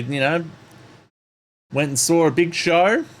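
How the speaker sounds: ordinary talk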